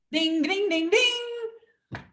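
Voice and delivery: high, sung bell dings; mock bell ding. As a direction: ring it like a quiz bell. Bell sound for a point.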